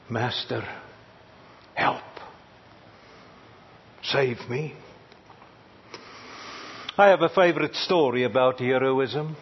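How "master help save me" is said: soft, much quieter cry of Master help; hushed plea. Retold or imitated. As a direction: pray it low and small, almost to yourself. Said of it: "shouting like Peter did".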